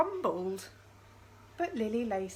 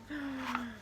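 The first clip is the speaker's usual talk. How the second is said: quick hushed gasp of suspense; soft gasp; 3 semitones higher, 6 dB quieter than usual. Pretend. Reading to children, gasping at the suspense. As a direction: gasp as if scared.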